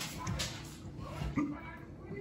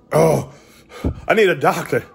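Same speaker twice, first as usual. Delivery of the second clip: hammed-up call, higher and louder; mock plea for help; 8 semitones higher, 5 dB louder, slower than usual. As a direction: overplay the desperate patient. Joking plea after hot pepper.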